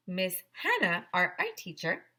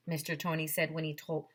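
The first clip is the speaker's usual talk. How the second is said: plain reading voice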